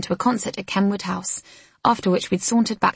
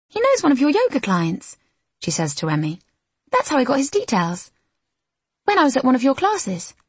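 bright; 6 semitones higher than usual. Acted. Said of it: "says Lizzie brightly".

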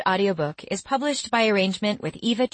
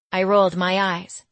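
ordinary delivery, nothing marked

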